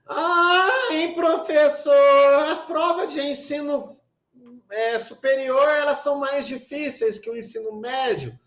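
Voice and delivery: high, drawn-out student whine; mock whining student. Teacher imitating a student worried about exams, mock whining. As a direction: whinge to the teacher in a high, stretched voice.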